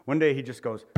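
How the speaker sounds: ordinary talk, nothing marked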